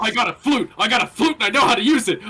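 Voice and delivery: loud, high, fast brag; boastful. Acted. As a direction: sell yourself proudly. Bard boasting.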